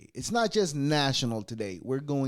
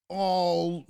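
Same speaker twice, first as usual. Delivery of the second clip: usual speaking voice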